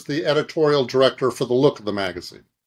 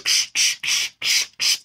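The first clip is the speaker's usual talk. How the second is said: unvoiced shushing ksh sounds, quick and rhythmic; mimicked paper-flipping noise; slower than usual. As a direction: imitate someone flipping fast through a magazine. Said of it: "he goes".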